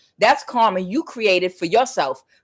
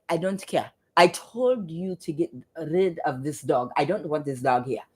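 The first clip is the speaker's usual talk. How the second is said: low, softening, dismissive retort; dismissive father; 3 semitones lower, 6 dB quieter than usual. Retold or imitated. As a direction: imitate a father laying down the law quietly. Retold father refusing the dog.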